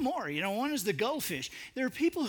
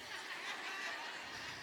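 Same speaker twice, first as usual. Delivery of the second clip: high laugh; 10 semitones higher, 14 dB quieter than usual. Real, not acted. Laugh after the punchline.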